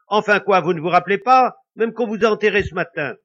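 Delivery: brutal. A narrator's reading. Wife telling the ghost he is dead, loud brutal retort. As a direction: hit him with it bluntly. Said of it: "dit la femme brutalement".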